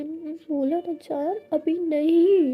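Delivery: slow drawn-out no, holding back the surprise; drawn-out insistence. Acted. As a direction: stretch every word, firm but playful. Insisting on waiting.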